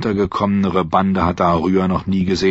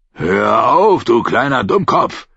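louder, angry yell; angry shout; 2 semitones higher, 4 dB louder than usual. A narrator's reading. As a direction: bellow at the struggling girl. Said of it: "schrie er".

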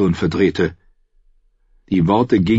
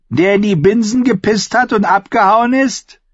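mocking squire; 5 dB louder than usual. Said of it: "squire keeps taunting".

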